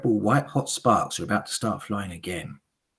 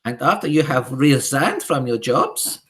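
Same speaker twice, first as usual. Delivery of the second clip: Grand High Witch; 5 semitones higher, 7 dB louder than usual. Acted.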